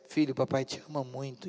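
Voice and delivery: low, soft fatherly affection; tender. Retold or imitated. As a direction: say it quietly, as a loving father. Pai declara amor ao filho. (carinho baixinho de pai)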